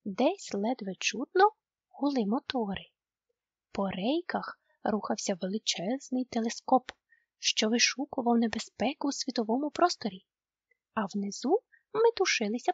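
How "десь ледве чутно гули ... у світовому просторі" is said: ordinary delivery, nothing marked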